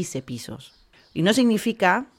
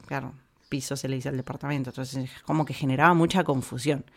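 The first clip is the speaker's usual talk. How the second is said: ordinary talk, nothing marked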